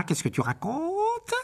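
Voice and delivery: drawn-out question swooping high at the end; baffled scorn. Acted. Master questioning his elf's claim, incredulous. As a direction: stretch the last word upward.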